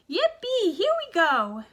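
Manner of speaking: high, loud whoop; yippee shout. A narrator's reading. Grandpa Joe cheering in the rising elevator, loud whoop. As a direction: cheer it out as you shoot up. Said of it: "shouted Grandpa Joe again".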